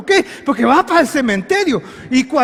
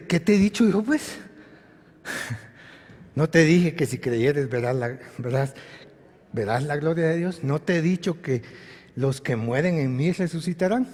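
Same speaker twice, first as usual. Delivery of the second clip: low, hushed answer of Jesus; calm reproof; 8 semitones lower, 8 dB quieter than usual. Retold or imitated. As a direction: remind her quietly, much lower and softer.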